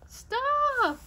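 high, loud cry of stop; shouted stop. Acted. Harold the elephant crying out to stop Hog. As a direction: halt him with a sharp shout.

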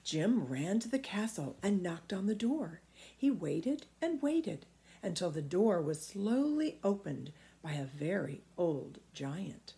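plain reading voice

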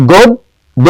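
গদ্য in two separated beats, "god... do"; pronunciation demo. Shown as a demonstration. Teacher showing students the sound of গদ্য, syllable by syllable. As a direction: stretch গদ্য syllable by syllable with a closed "o". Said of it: "উচ্চারণগুলো দেখি একটু".

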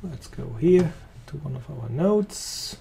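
ordinary talk, nothing marked

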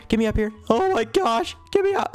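high-pitched, panicked cries; panicked pleading. Real, not acted. Player begging to get up from the tsunami, panicked pleading.

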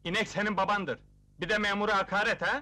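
loud, offended official's rebuke; indignant scolding. Acted. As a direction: take offence and raise your voice.

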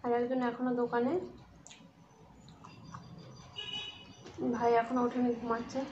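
talking with mouth full; eating while speaking; chewing food. Real, not acted.

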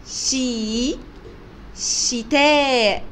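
long held شي, then a slow, stretched شتاء; sounding out. Shown as a demonstration. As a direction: model how شتاء begins with شي. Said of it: "بيبدأ بصوت شي".

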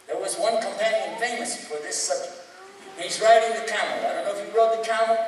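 ordinary talk

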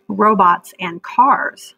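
Robots and cars read slowly; reading the heading. Shown as a demonstration. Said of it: "these are all different headings".

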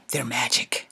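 hushed secret whisper; conspiratorial whisper. Acted. To the audience, a whispered secret.

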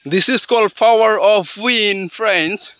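ordinary talk

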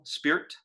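"spirit" clipped to "sprt", one beat, breathy; spirit in one syllable. Shown as a demonstration. Teacher demonstrating elision, "spirit" as one syllable. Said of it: "Spirit almost always elides to one syllable".

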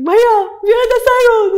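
high, loud hammed-up wail; mock crying. Hailing a rickshaw driver in tears, mock crying. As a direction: wail it out as if bawling. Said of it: "I'm crying and I'm like".

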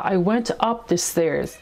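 very slow, carefully spaced words; slow clear classroom example; slow model sentence. Shown as a demonstration. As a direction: spell it out slowly for learners. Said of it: "یا مثلاً".

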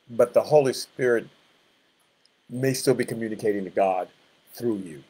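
usual speaking voice

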